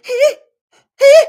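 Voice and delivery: very high, loud falsetto squeal; a shrieking pop-star hee-hee. Retold or imitated. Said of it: "I can't help but do [name]".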